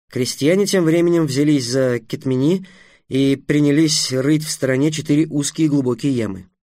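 usual speaking voice

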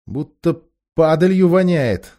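choked, disgusted gasp. A narrator's reading. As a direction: gasp at the stench. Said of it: "задохнулся Юстас".

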